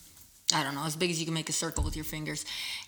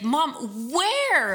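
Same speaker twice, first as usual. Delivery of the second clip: put-on much higher, louder toddler voice; child asking; 7 semitones higher, 6 dB louder than usual. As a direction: play the baffled little boy. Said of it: "And he's like".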